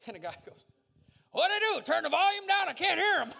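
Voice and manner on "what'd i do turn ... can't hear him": very high, loud yelled complaint; high-pitched backwoods shouting; older man hollering. Retold or imitated. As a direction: holler it down the table like an older man who can't hear. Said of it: "there's an older gentleman further down the table".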